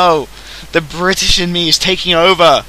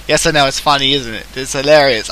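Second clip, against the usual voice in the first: normal talking voice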